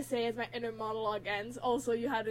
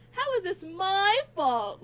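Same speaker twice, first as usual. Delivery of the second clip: high, slow, indignant protest; acted defensive whine; 6 semitones higher, 4 dB louder, slower than usual. A narrator's reading. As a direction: drag it out in wounded self-defence. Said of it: "Louis says defensively".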